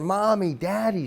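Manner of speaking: high, loud crying calls for his parents; retold child's screams. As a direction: scream for daddy and mommy like a hurt, terrified kid.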